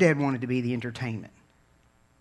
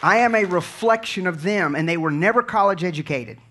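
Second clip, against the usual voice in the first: raised and louder, driving the testimony home; grateful preacher testifying about his grandparents, raised and loud; full-force preaching; 5 semitones higher, 6 dB louder than usual. Real, not acted.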